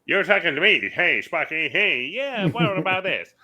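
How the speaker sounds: high-pitched cartoon character voice; Glenn Quagmire. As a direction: call out like the Family Guy character. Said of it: "Glenn Quagmire talks on, uh, Family Guy".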